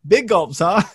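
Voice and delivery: lightly imitating a clueless movie character; mock cheerful brush-off; Dumb and Dumber quote. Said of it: "the movie Dumb and Dumber".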